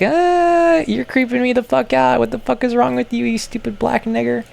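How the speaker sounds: put-on high, squeaky frightened girl voice; high squeaky mock-terrified doll; squeaky girl toy voice. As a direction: play a shrieking little toy character. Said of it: "you'd be like".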